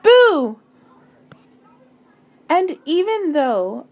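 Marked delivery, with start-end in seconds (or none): 0.0-0.6 loud high shout; yelled boo. Acted. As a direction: jump out and shout it. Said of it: "jump out yelling".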